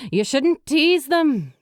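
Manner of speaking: scolding. A narrator's reading. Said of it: "Micah scolded".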